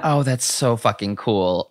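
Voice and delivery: usual speaking voice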